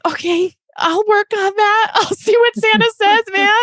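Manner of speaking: high, choked-up voice on the edge of tears; laughing through rising tears; tearful reply. Real, not acted. Mother answering her son's Christmas wish, choked up and tearful.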